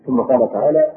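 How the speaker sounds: ordinary delivery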